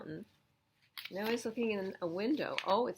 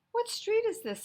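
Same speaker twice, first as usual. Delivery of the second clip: lost man asking; 7 dB louder than usual. Acted. Stranger asks Sammy directions.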